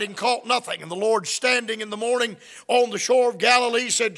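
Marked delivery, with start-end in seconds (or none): none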